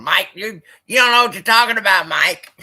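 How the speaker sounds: high, loud, fast mock rant at Mike; mock ragging on a buddy. Teasing his buddy Mike on the stream, in a hammed-up loud fast rant. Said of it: "Should I just start going".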